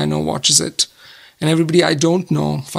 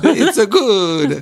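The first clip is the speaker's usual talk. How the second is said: put-on slow laughing Italian accent; 4 semitones higher, much slower than usual. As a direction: drawl it in a jokey Italian accent. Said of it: "weak attempt at an Italian accent".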